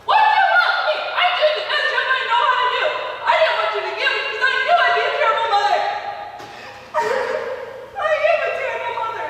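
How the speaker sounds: very high, loud, cracking half-laugh, half-sob; hysterical breakdown. Acted. Mother breaking down in front of her son, hysterical. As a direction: come apart completely.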